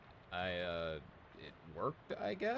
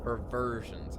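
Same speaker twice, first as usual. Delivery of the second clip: louder self-correction; self-correcting blurt; 3 semitones higher, 6 dB louder, much slower than usual. Real, not acted. Reading the word right at last, louder. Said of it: "I'm an idiot".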